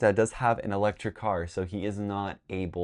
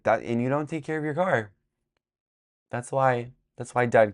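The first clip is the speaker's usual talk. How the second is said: higher-pitched nagging mom imitation; mock scolding; 4 semitones higher than usual. Mom blames her kid to Dad, mock scolding. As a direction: lightly imitate a parent telling someone off.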